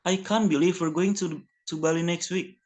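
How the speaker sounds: ordinary delivery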